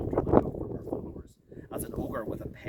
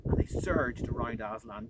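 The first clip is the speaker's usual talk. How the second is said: usual speaking voice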